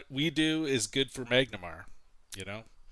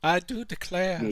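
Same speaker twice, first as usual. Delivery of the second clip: southern belle; 5 semitones higher than usual. Acted. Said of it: "it had some southern charm".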